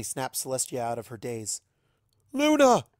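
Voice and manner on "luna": sudden high, loud cry of a name; cries out. Acted. Celestia crying out to her transformed sister, loud. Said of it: "she cries out".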